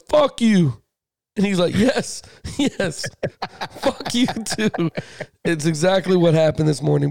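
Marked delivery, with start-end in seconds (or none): 0.1-0.8 higher, mildly raised mock yell; retold yell. Imitating New York neighbors answering a greeting, mild mock yell. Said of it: "everybody yells". 1.8-4.9 higher, delighted movie-character reply, drawn out at the end; Eddie Murphy. Retold or imitated. Quoting Coming to America to the co-host, delighted mock reply. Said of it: "When Eddie Murphy's like".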